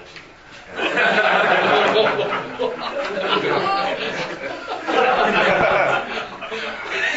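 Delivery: laughing at his mentor's rebuke; long laugh. Real, not acted. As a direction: let a long high laugh run out. Laughs at the punchline.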